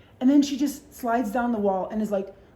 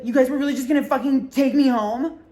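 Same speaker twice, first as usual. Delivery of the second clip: louder, mock-outraged; indignant drunk; 4 semitones higher, 6 dB louder than usual. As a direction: act betrayed by your friends.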